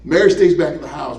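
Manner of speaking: normal talking voice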